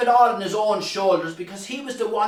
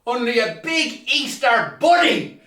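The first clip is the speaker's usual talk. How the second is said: ordinary delivery, nothing marked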